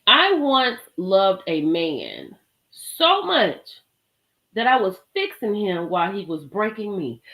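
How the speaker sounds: slow, deliberate reading of the quote; slow quoted line. Retold or imitated. Reading her quote slowly to the viewers, deliberate. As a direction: let each word land slowly. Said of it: "When she said".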